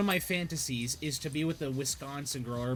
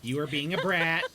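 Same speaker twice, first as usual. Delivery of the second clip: ordinary talk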